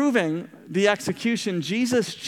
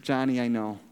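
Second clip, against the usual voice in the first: tender grandmother; 5 semitones lower than usual. Retold or imitated. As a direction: speak gently, full of love. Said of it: "beautiful comment".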